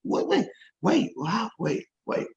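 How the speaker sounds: high, slow, stunned wait wait wait; stunned disbelief. Retold or imitated. Retelling the family's call, high, slow stunned disbelief. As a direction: stop them, you can't believe it's you. Stunned at being asked to speak.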